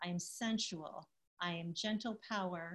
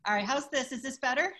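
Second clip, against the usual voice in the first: speaking up much louder; 5 semitones higher, 10 dB louder, faster than usual. Real, not acted. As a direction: push the voice out louder. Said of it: "Could you please be louder?".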